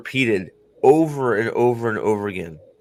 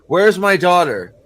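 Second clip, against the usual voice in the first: mock shout, higher and louder; hammed-up angry mother's demand; 5 semitones higher, 8 dB louder than usual. Mother bursting in demanding her daughter, mock shout.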